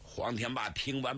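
ordinary delivery

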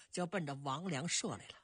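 ordinary delivery, nothing marked

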